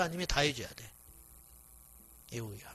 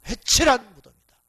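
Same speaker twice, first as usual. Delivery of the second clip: loud, raised-pitch rebuke; stern denunciation; 5 semitones higher, 13 dB louder than usual. Acted. Jesus rebuking the Pharisees, loud stern denunciation. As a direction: hurl it out as a forceful accusation.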